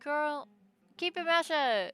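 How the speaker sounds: high, loud telling-off; loud scolding. Real, not acted. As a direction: shout her down, half joking. Streamer scolding game character.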